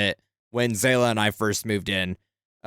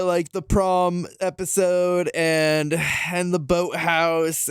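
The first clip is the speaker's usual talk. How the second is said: ordinary talk, nothing marked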